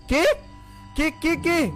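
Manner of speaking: startled, stammering high calls; frightened. Acted. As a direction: call out in fright. Narrator startled awake at night.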